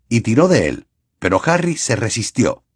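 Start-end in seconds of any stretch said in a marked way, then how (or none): none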